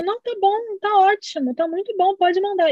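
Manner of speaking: high-pitched, insistent mum voice; imitated reassuring mother. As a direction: act out mum waving off worries.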